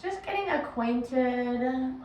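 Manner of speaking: measured meditation guide, long pauses between phrases; guided meditation. Acted. Yoga teacher guiding listeners into breath and body, guided meditation. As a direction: lead them inward unhurriedly, letting each instruction settle.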